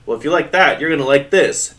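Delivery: a mocking dummy threatening the girl, loud; taunting dummy. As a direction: threaten like a gleeful evil dummy. Dummy taunting before the goo.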